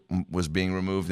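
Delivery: normal talking voice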